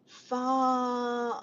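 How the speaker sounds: "Far" drawn out on one held note; struggling to pronounce. Real, not acted. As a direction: stretch "Far" long while attempting an unknown name. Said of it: "I can't even say that, butcher it".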